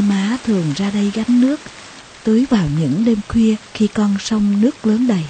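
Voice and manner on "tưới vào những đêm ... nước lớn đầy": ordinary delivery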